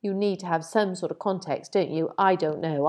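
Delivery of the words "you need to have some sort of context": normal talking voice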